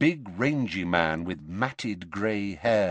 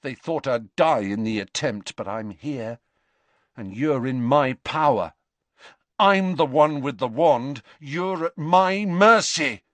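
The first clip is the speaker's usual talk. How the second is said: from near-usual voice up to high, loud insistence; defiant boast, building; 5 semitones higher, 5 dB louder than usual. A narrator's reading. Boy threatening Dumbledore with wand, increasingly defiant.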